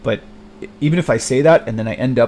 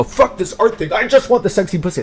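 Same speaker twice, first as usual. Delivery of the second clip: higher and louder, giving in gleefully; mock-excited surrender, pitched up; eager outburst; 10 semitones higher, 3 dB louder than usual. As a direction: throw the art away like a kid grabbing candy. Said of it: "And I go".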